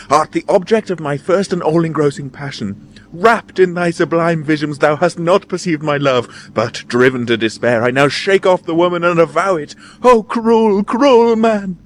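high, theatrical outpouring of love; passionate declamation. A narrator's reading. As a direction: build to a wailed reproach. Lovelorn lady declaims passionately.

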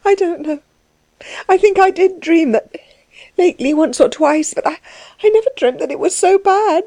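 high, halting, sobbing lament; weeping reproach, pitched high; tearful crying. Acted. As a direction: cry it out high, breaking off between phrases. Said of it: "she cried, looking up at him, the tears rolling".

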